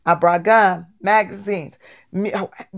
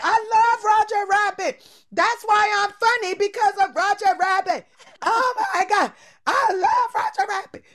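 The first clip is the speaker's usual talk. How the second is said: very high, squealing starstruck fan; mock fangirl screaming; 12 semitones higher than usual. Girl telling the comedian she adores his character, mock screaming. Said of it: "I start screaming at the top of my lungs".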